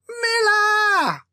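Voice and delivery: frantic shout for help; panicked yell. Acted. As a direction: yell for your brother in panic. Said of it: "he yelled, more panicked now".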